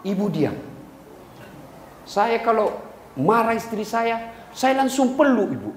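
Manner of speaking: ordinary delivery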